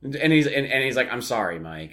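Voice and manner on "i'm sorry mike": put-on low, quiet New York-accented apology; lightly put-on New York accent. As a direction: imitate a soft-spoken New York accent. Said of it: "talks like he's from fucking New York".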